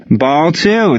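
high, carrying umpire call; umpire's yell. A narrator's reading. Umpire announcing the count to the field, yelled. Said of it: "the ump yelled".

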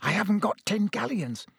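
ordinary delivery, nothing marked

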